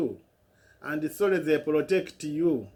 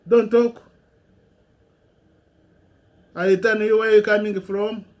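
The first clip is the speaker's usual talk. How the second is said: loud, raised, furious command to be silent; angry shout; 5 semitones higher, 7 dB louder than usual. Real, not acted. Shouting down the people who criticise him, furious. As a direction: cut them off hard and loud.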